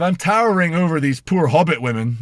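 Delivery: normal talking voice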